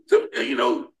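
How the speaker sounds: ordinary talk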